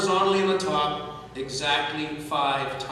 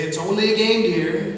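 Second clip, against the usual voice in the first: higher, louder, soothing mother; calm a sore loser, a bit high; 5 semitones higher, 7 dB louder than usual. Retold or imitated. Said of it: "Nanny would say".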